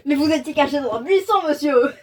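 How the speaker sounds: plus fort, en interpellant le personnage; lance-lui ça comme un reproche amusé; exclamation indignée. Real, not acted. (la lectrice s'indigne contre Ox, d'une voix forte)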